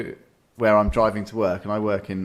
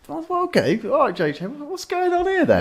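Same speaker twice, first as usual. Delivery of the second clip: much higher, puzzled mock mechanic-shop wonder; baffled inner thought. Spotting a strange jacked-up car in the unit, much higher baffled wonder. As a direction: pitch it up like a nosy onlooker. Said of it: "I was thinking".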